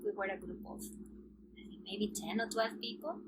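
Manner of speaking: ordinary delivery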